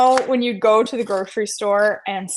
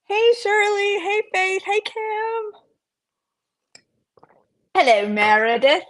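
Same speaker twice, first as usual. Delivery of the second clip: hellos pitched way up; singing out greetings; high sing-song greetings; 11 semitones higher than usual. Real, not acted. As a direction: call each name high. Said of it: "I don't know why I'm talking like that".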